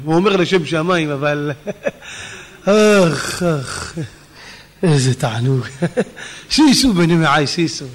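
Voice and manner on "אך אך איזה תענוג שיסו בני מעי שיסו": mock savouring. Mimics man savouring his food.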